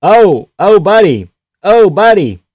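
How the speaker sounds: high, loud "oh buddy" exclaims; pained fan watching a beating; excited wincing. Real, not acted. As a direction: feel the hit land on him. Fighter getting hurt.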